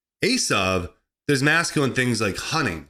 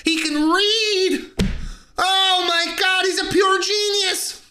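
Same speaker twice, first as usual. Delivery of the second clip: very high, drawn-out squeal of awe; mock amazement; 14 semitones higher, slower than usual. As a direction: gush in a hammed-up falsetto, stretching the words. Mock amazement at someone who reads.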